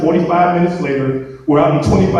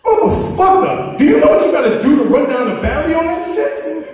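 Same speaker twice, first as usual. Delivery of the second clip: incredulous scolding; 12 semitones higher than usual. Retold or imitated. Scolding a friend over the dead battery, incredulous. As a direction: scold him in mock disbelief. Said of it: "like I told his ass".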